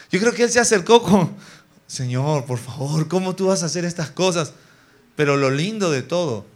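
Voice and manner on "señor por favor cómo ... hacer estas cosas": low, hushed nervous pleading; acted fear. Preacher as Moses approaching the serpent, timidly fearful plea to God. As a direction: plead softly, as if edging toward danger. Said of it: "con miedo".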